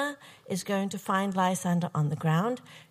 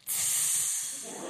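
long hissed "tsss" sizzle, imitated; sizzle sound; 6 dB quieter than usual. Partygoer admiring her swimsuit, imitated sizzle. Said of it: "someone really did this".

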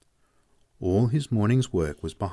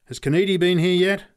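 much higher, louder call upward; shouted up; 9 semitones higher, 6 dB louder than usual. Acted. Pat shouting up to the man at the hoist, loud call. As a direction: call up through the opening. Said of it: "shouted at one of the men".

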